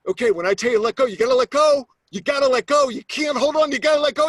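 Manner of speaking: high, loud, insistent yelling; shouted instructions. Retold or imitated. Instructor to jumper out on the wing, shouted instructions. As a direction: yell each instruction over roaring wind.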